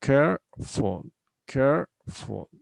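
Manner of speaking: "careful" slowly split as care, ful; two separate syllables. Shown as a demonstration. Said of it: "we have only two syllables".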